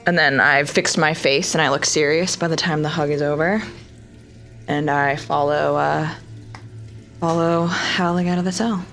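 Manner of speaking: usual speaking voice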